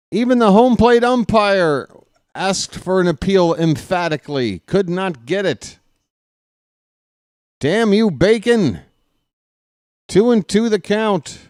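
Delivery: baseball announcer building tension; play-by-play call. Acted. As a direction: call each pitch like a radio broadcaster, growing keyed up. Announcer calling a ball game.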